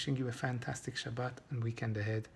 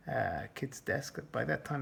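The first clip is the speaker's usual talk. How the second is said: normal talking voice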